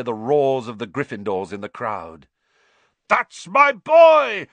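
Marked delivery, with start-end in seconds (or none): 3.1-4.5 slow, very loud, high triumphant yell. A narrator's reading. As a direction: shout it across the pitch in delight. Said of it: "Wood kept yelling".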